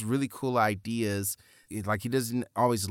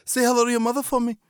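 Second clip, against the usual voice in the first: put-on accent slipping to Liverpool; 12 semitones higher, 5 dB louder, much faster than usual. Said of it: "became [name] there for a second".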